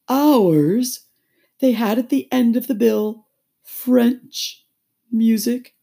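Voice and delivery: high, loud, very slow; stretched, self-important turtle voice; doleful Mock Turtle. A narrator's reading. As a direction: spell out the list, drawling. Mock Turtle explains the school bill.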